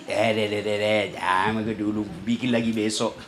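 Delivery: whispering tempter, low and soft; devil whispering. Acted. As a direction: coax quietly like the devil in his ear. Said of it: "setan bisik ki".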